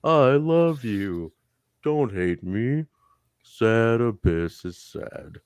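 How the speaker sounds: slow pleading lovesick mope; sad giant's plea. Retold or imitated. Abyss pleading with Goldie, slow and mopey. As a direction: beg her slowly, heartbroken. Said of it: "he's like".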